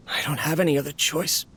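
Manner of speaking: ordinary talk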